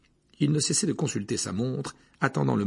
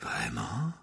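lower, eager whisper with a rise; 10 semitones lower, 5 dB quieter than usual. A narrator's reading. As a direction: whisper it, hungry for more. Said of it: "chuchota le professeur Trelawney".

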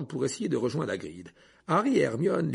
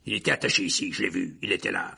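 mild angry exclamation, lower pitch; furious; 5 semitones lower than usual. A narrator's reading. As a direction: protest with understated fury. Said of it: "s'exclama le bourreau avec fureur". (colère contenue, voix plus grave)

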